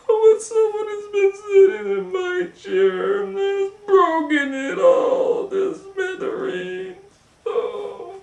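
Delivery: very high wailing sing-song cry; Baby Bear crying. Acted. Baby Bear over his broken chair, high and wailing. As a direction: sob it out like a heartbroken cub. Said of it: "And then Baby Bear goes".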